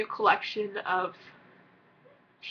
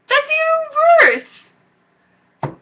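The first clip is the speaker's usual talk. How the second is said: anguished wail; 6 dB louder, much slower than usual. Retold or imitated. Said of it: "I was like".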